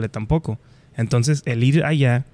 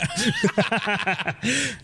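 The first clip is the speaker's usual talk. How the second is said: high laugh; 10 semitones higher, 6 dB quieter than usual. Real, not acted. Laughing at own joke.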